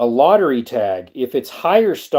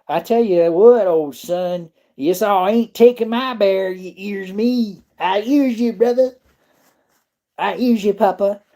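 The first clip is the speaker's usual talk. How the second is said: higher, hammed-up backwoods twang; hillbilly voice; 7 semitones higher than usual. Bantering with a viewer who called him old son, hillbilly twang. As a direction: joke back and forth like old country kin.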